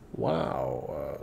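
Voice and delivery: very low, hushed amazement; understated, retold astonishment; stunned wow. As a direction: let it fall deep and quiet, taken aback. Retold reaction to her bombshell.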